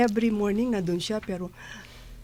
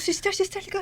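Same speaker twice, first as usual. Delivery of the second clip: higher urgent call for the sister; 10 semitones higher, faster than usual. Retold or imitated. At mass, she calls Sister Crescencia over urgently. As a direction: hurry to wave the sister over.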